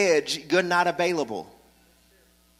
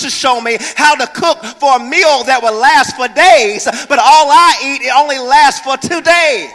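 high, loud, hammering preacher; much higher and louder, rising preacher's cadence; preaching at full force; 8 semitones higher, 11 dB louder than usual. Real, not acted. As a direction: drive it home to the congregation.

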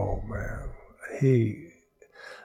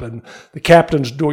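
ordinary talk

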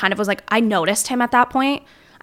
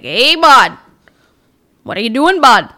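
put-on Plattsburgh accent; 2 semitones higher, 8 dB louder than usual. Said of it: "the Plattsburgh accent of the people that talk like".

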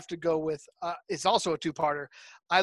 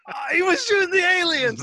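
high, louder shouted discovery; excited realization; 12 semitones higher, 4 dB louder than usual. Retold or imitated. Viewer realizing the kid was right, excited shout. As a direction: shout it as a sudden revelation. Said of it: "And you're like".

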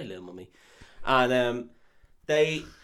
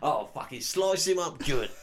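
mock Cockney threat; 4 semitones higher, 8 dB louder than usual. Continuing the Cockney thug.

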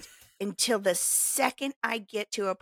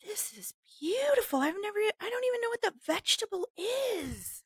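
amazed; 12 semitones higher than usual. Retold or imitated. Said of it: "walking through there and going".

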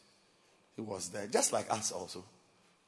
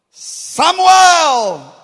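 high, loud, long shouted name; divine call; 23 dB louder than usual. Acted. The Lord calling the boy, booming shout.

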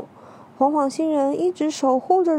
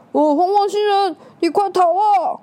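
urgent shout; 8 semitones higher, 6 dB louder than usual. Acted. Bystander begging him to flee.

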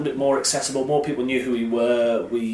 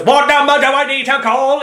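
high, loud robotic shouting; Dalek; 12 semitones higher, 7 dB louder than usual. Retold or imitated. Super fan asking Alfie for a number, shouted Dalek voice. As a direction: yell the question in a machine voice. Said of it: "Will you just stop shouting at us?".

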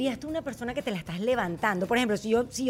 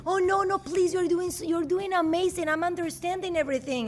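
gushing American; 6 semitones higher than usual. Retold or imitated. American praising a struggling learner, gushing high. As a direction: lay on sugary encouragement, pitched up.

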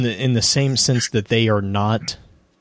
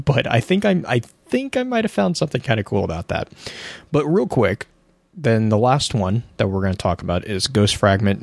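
ordinary delivery

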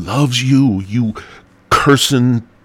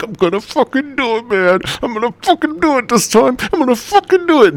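high, strained, building mock despair; hammed-up desperate whine climbing higher; mock desperate self; 4 dB louder than usual. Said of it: "I'm like".

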